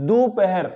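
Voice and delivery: दोपहर with "u" first: "du-pahar"; दोपहर opening on "du", not "do"; correct pronunciation. Shown as a demonstration. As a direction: say दोपहर as "doo-pa-har". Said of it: "इस प्रकार से दोपहर बोले हम".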